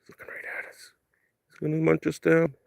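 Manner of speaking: whispered, hushed near the deer; wildlife watching whisper. Real, not acted.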